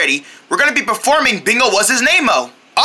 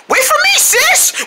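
high calling out; 14 semitones higher than usual. Acted. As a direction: shout after her as she leaves. Calling after sister.

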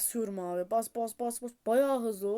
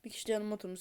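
usual speaking voice

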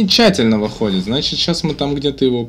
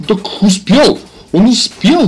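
excited shout; 9 semitones higher, 8 dB louder, much slower than usual. Real, not acted. Excited commentary on a close escape.

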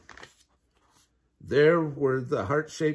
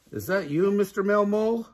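puzzled question; 7 semitones higher than usual. Acted. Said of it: "she asked".

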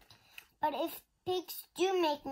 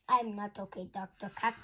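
usual speaking voice

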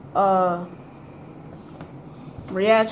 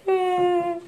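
high drawn-out "eh"; pitched-up fake whimper; mock crying whine; 9 semitones higher than usual. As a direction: imitate a kid starting to wail. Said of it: "started crying and stuff".

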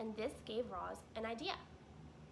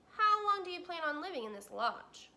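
higher, louder beaver; Mister Beaver asking; 7 semitones higher, 8 dB louder than usual. Acted. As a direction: ask it heartily as the beaver.

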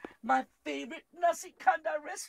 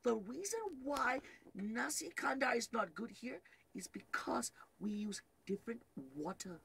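secretive whisper; 4 semitones lower, 6 dB quieter than usual. Retold or imitated. Telling the owner's secret.

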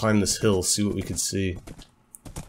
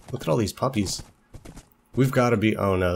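usual speaking voice

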